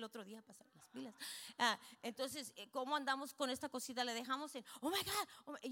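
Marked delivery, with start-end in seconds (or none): 4.8-5.7 high-pitched flustered alarm; mock panic. Preacher imitates someone who lost their card, mock panic. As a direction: act out a sudden panic, pitched up.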